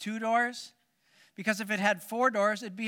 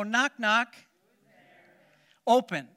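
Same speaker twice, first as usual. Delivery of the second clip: playful joke delivery; 5 semitones higher, 5 dB louder than usual. Acted. Pastor tells a knock-knock joke.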